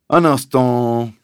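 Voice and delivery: slow, loud, drawn-out objection; police sergeant. Acted. Sergeant stopping the landowner's tirade, slow and ponderous. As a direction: halt them with ponderous authority.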